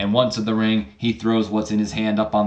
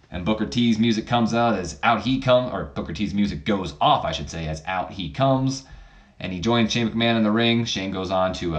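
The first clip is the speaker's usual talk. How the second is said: ordinary talk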